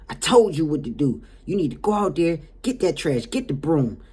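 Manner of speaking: acted-out scolding client; imitated bossy tirade. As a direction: rattle off orders like an irate client. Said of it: "I mean, she just tripping".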